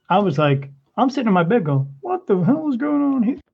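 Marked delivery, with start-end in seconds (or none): 2.0-3.4 high, startled retold question; startled disbelief. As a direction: pitch it up in shocked disbelief. Startled in bed by the box.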